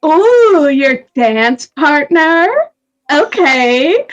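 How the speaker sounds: high drawn-out "ooh", then slow insinuation; playful knowing lilt; teasing. Acted. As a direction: tease them about a crush. Player teases magician about dance partner.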